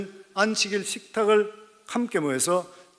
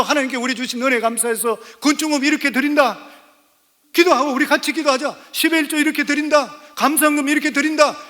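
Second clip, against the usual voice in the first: put-on a parent's prayer shown to the children in a raised preaching voice; model family prayer; 6 semitones higher, 5 dB louder than usual. Shown as a demonstration. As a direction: demonstrate giving thanks aloud before the kids. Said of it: "건축업무 할 때도".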